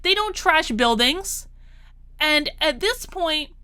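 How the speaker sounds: ordinary delivery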